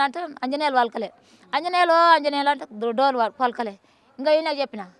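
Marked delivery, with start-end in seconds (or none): 1.5-2.5 calling out louder. Retold or imitated. Mother calling again, louder.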